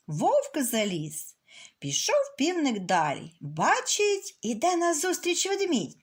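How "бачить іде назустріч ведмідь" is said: ordinary delivery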